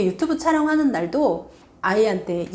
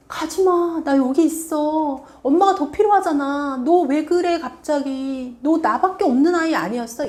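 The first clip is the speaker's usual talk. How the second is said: clingy pleading; 6 semitones higher than usual. Retold or imitated. Clingy parent begging child stay.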